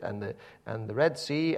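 usual speaking voice

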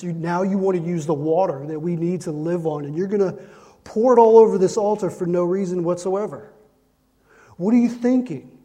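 outraged crowd. Retold or imitated. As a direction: voice the crowd's fury rising to a yell. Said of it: "these people were thinking".

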